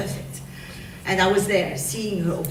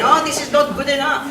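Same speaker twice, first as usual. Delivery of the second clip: higher, louder strict objection; mock-strict telling-off, pitched up; 7 semitones higher, 6 dB louder than usual. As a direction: imitate a strict perfectionist, voice raised. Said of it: "quite strict in her instructions".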